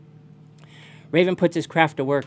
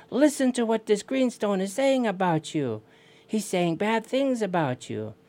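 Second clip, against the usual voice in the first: high, insistent call; high-pitched storyteller's holler; yelling to the salmon; 7 semitones higher than usual. Acted. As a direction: call across the water to get attention. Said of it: "So he yells at the king salmon".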